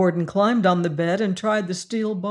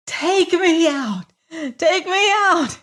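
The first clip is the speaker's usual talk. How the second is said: slow, high, louder screaming in terror; terrified scream; 11 semitones higher, 5 dB louder, much slower than usual. A narrator's reading. Prisoner begging the warden to free him, screaming in terror. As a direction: scream to be let out, panicked. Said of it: "he screamed".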